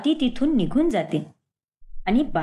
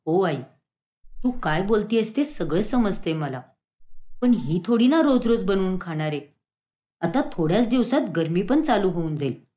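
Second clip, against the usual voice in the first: lightly voiced husband, rather low; placating son; 6 semitones lower than usual. Ketan assures his mother it will stop, placating. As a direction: reason gently with mother.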